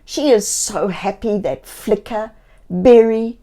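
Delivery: plain reading voice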